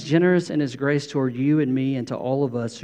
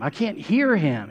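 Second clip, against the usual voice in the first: usual speaking voice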